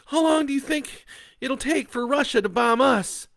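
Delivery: high, loud anxious question; frightened. Acted. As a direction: Leah asking Bo about the bombs, high and frightened. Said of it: "Leah asks".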